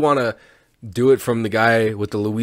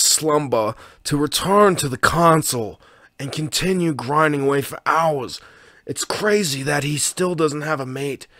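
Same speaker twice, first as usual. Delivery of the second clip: higher, hushed nature-documentary narration; posh BBC wildlife narrator lightly done; British documentary narrator; 5 semitones higher than usual. Pretend. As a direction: narrate him like a wildlife film. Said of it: "older British gentleman narrating your life".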